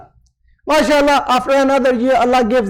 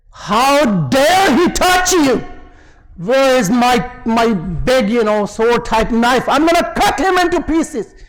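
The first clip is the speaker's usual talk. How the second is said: mock furious. Youngest son threatens his father for hurting mama, mock furious. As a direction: play the enraged youngest son, hammed-up. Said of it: "makes a furious face".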